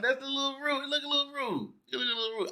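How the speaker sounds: high, soft little voice; small, hushed mock-prim aside; quiet disapproving thought. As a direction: murmur it high, as a private judgment. Voices own disapproving inner thought.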